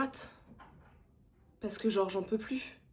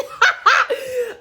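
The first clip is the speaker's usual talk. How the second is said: loud, very high burst of laughter; high cackling laugh; 13 dB louder than usual. Real, not acted. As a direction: crack up at yourself. Laughs at herself.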